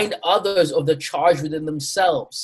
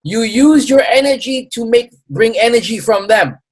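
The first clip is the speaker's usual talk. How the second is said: higher, louder mock Chinese accent; a lecturing Chinese accent, pitched up; bad Chinese accent; 8 semitones higher, 5 dB louder than usual. Acupuncturist advising the smart dragon, mock Chinese accent. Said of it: "a really bad version of his accent and his vo".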